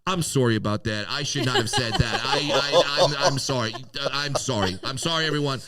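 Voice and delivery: high, flustered over-apology; hammed-up embarrassed apology; apologetic Canadian heckler. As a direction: gush sorry like a mortified polite Canadian. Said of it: "and he goes".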